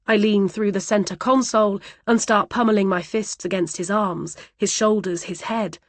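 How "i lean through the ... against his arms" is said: plain reading voice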